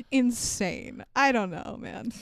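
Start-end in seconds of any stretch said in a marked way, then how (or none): none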